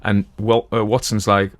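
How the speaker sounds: ordinary delivery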